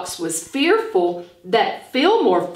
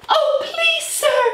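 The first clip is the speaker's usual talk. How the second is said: much higher, pleading little fox. Acted. Fanny Fox begging Hi-Hat to hide her, high and pleading. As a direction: beg like a frightened small fox. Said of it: "said Fanny Fox".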